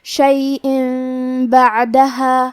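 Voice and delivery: شیئن بعدہا with the tanween turned to a nasal م before ب, very slow; iqlab. Shown as a demonstration. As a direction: close the lips humming م into بعدہا. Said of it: "حکم ہے قلب تو ہم یہ حکم کو غنہ کے ساتھ".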